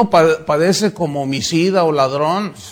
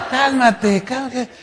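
higher, laughing through it; laughing calm-down; 7 semitones higher, slower than usual. Retold or imitated. God telling the angry pastor to calm down, laughing. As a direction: chuckle while soothing.

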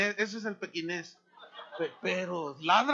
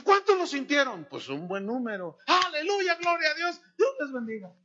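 louder, higher hallelujah cry; mock jubilant preacher; 6 semitones higher, 5 dB louder than usual. Preacher praising the crowd, loud and high. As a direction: ham up the preacher's triumphant praise. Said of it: "Y dice".

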